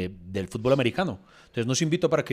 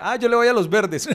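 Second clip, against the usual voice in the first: clueless friend; 6 semitones higher, 3 dB louder than usual. Retold or imitated. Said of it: "Henry era".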